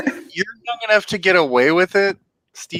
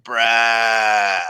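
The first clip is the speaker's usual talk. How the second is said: stoner surfer voice; 5 semitones lower than usual. Retold or imitated. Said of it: "it's like his normal voice, but".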